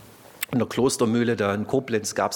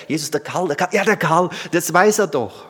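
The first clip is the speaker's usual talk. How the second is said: louder, fumbling for a forgotten name; hammed-up groping for the name, getting louder; mock stammering prayer; 4 semitones higher, 5 dB louder than usual. Preacher mocking a fumbling prayer, loud and halting. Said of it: "da fällt mir der Name nicht ein".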